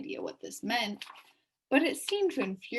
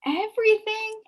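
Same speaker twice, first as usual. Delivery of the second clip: admiring; 13 semitones higher, 6 dB louder than usual. A narrator's reading. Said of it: "asked Milo admiringly".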